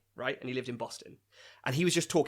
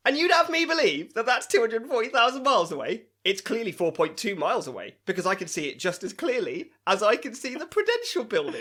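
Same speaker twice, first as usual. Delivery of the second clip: higher, louder smug mocking quote; mock conspiracy vlogger; 9 semitones higher, 6 dB louder than usual. Podcaster mocks the moon-denying vlogger's smug claim. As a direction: sneer it like a smug crank. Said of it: "And he goes".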